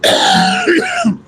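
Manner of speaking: dry hacking cough; sharp throat cough. Real, not acted. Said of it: "Excuse me".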